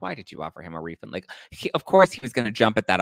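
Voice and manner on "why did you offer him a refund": soft fast inner thought. Retold or imitated. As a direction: mutter it to yourself quickly. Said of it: "In my head".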